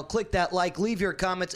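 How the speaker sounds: ordinary talk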